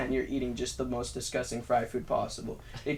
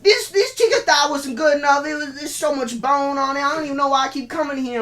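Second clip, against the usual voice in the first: higher, louder, complaining customer voice, quicker at the end; whiny customer; 10 semitones higher, 8 dB louder than usual. Retold or imitated. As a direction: imitate a customer moaning about a bony chicken thigh.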